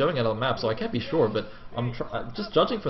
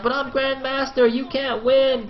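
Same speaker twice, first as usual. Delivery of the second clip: high, whiny imitation of the sore loser; mock whiny player; 11 semitones higher, slower than usual. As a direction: whine it like a kid who lost, pitched well up. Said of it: "He's like".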